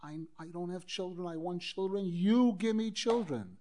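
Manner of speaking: normal talking voice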